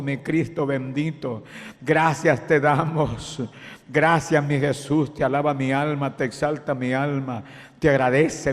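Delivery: oración de alabanza sostenida; oración ferviente. Acted. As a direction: dar gracias a Cristo con fervor. Ora en voz alta. (oración de alabanza ferviente)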